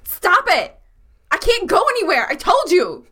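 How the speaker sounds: high, loud protest; an annoyed character yelling at the monster. Acted. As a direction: shout her to stop. Player's character yells at centipede.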